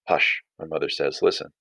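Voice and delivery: low, measured read-aloud narration; reading a novel excerpt aloud to students; story reading. A narrator's reading. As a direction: read the story to the class, steady. Teacher reads story aloud.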